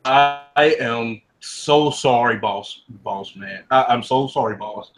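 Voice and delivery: slow, low, cowering apology to the boss; a servant grovelling low and slow before his boss. Acted. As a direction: beg forgiveness, shrinking away.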